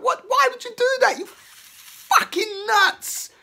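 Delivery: shrieking high outrage, dragged out at the end; furious disbelief. Pretend. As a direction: yell at them like they've lost their minds. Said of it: "That's the energy that's inside".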